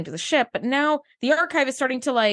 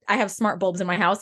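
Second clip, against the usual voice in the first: ordinary talk, nothing marked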